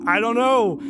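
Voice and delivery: baffled protest. Retold or imitated. Repeats his baffled reply, retold.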